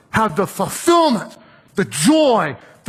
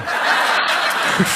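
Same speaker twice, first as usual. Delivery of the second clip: laugh. Real, not acted. Laughing at own story.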